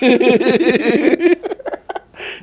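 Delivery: a big high laugh; loud laugh. Real, not acted. Host cracking up at her own impression, a big high laugh. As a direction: burst out laughing.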